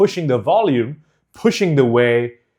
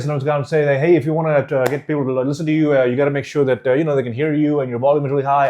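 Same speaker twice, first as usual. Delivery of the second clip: words combined together, hurried and unenunciated; rushed run-on speech; much faster than usual. Shown as a demonstration. Coach showing unclear speech to students, rushed and run-on. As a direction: rattle it off fast, syllables blurring, with filler words. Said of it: "if I was gonna talk like this".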